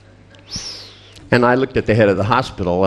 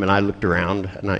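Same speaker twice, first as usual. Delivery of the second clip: ordinary talk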